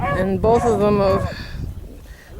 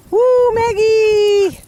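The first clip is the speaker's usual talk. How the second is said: excited cheer; 11 semitones higher than usual. Acted. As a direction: cheer like it's a party. Cheering dogs during storm.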